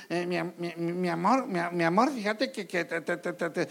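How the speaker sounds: mock stammer, nasal and halting; hammed-up stuttering nasal voice; stammering nasal husband. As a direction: stutter nervously like a timid husband. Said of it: "todo medio tartajo y con la voz así como gangosa".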